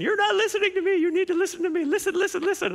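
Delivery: put-on high, insistent little-girl voice; imitating little daughters. Daughters demanding their daddy listen, high and insistent. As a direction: pipe up like small girls nagging dad.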